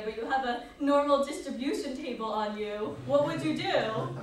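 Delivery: put-on quoting a professor in a light Polish accent. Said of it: "That was a really bad accent".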